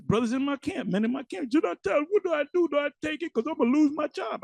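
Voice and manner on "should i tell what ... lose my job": worried plea. Retold or imitated. Imitating anxious brothers.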